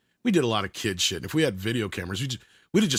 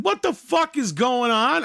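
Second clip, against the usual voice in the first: high, slow retold shout; loud outraged yell; 12 semitones higher, 4 dB louder, slower than usual. Seeing the cop slam Ryan, loud outraged yell. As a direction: draw it out in high-pitched disbelief. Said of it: "I said that so loud".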